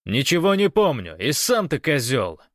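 loud, high retort; indignant shouting across a distance; defiant shout. A narrator's reading. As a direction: yell back an insult. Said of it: "крикнул гость".

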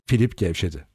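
ordinary delivery

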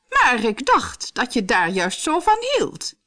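high, slow queenly voice; feigned puzzlement from a storybook queen. A narrator's reading. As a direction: draw it out in a high, put-on voice. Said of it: "zei koningin Eleanora".

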